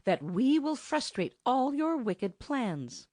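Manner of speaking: slow, lifted reproach of his wicked plans; reproving. A narrator's reading. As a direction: scold him slowly, word by word. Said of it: "she said reprovingly".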